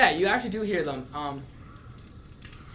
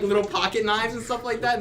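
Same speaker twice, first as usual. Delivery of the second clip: usual speaking voice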